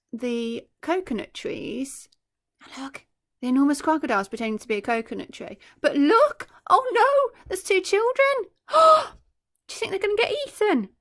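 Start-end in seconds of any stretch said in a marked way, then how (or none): 5.8-10.9 put-on high, louder cry of worry for the children; mock alarm. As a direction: sound scared for them, playfully. Worried reader sees children.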